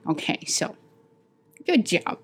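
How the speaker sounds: ordinary talk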